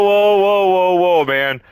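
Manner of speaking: high, loud drawn-out whoas, easing down; mock alarm, backing off from trouble; retold alarmed protest. Retold alarmed refusal to men offering drugs.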